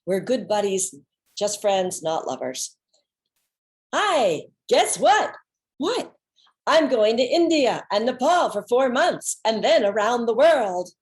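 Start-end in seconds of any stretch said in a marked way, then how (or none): 3.9-5.4 high, loud, bursting with news; excited news. Retold or imitated. Keating calling his friend, bursting with excited news. 5.8-6.1 curious what. Retold or imitated. Reacting to Keating's teaser. 6.7-10.9 loud and high, thrilled about the trip; excited announcement. Retold or imitated. Keating telling his friend about the trip, thrilled.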